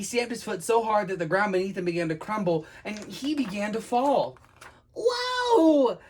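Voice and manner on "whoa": very high, loud "whoa"; long high shriek; falling scream. Acted. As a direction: scream while plunging down. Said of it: "he cried as he hurtled down".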